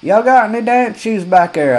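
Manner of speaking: loud, high echo of the clerk's call; mocking yell. Customer mocking the clerk's yell to the back room. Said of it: "I says".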